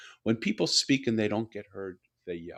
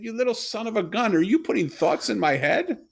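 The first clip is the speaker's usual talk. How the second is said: retold excited outburst, much higher and louder; amazed delight; 11 semitones higher, 9 dB louder than usual. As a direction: exclaim it in amazed disbelief. Father realizes daughter's telepathy.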